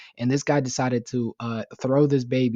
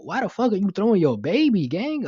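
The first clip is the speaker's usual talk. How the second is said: outraged disbelief; 9 semitones higher, 4 dB louder than usual. Real, not acted. Outraged at father throwing baby.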